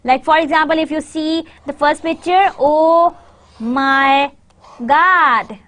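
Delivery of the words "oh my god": "Oh... my... God" word by word, drawn out; overstressed words. Shown as a demonstration. Teacher shows learners the wrong way, "Oh my God" broken up. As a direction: stretch each of "Oh", "my", "God" separately. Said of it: "this word is not God".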